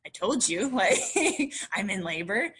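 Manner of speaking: laughing I-told-you-so; laughing smugly at the nurses. Retold or imitated. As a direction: gloat through a laugh. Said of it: "I just went like".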